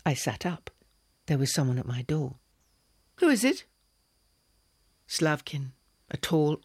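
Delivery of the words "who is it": high, louder alarmed call; startled. A narrator's reading. Woman hearing someone at her door at night, alarmed high call. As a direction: call out sharply into the dark.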